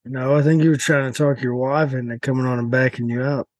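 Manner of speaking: usual speaking voice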